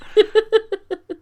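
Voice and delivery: a high laugh that falls off; burst of laughter. Real, not acted. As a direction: laugh at the punchline. Laughing after the text.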